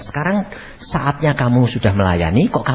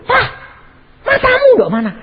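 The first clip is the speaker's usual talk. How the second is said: mock scolding; 6 dB louder than usual. Wife scolding husband.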